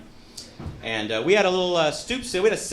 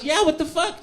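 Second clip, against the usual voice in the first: higher mock indignant driver; driver agreeing; 11 semitones higher than usual. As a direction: driver scolding his own car. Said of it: "the human in the car goes".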